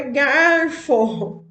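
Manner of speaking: insisting on garfo, slow; correct garfo. Retold or imitated. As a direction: say garfo with the R, drawn out to correct them. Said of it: "Aí eu falei".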